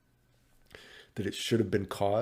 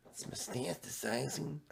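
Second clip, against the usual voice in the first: high, quiet squeaky cartoon voice; Pikachu voice attempt; 6 semitones higher, 7 dB quieter than usual. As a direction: try out a tiny squeaky creature. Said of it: "Should I try to do the Pikachu voice".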